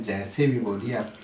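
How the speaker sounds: usual speaking voice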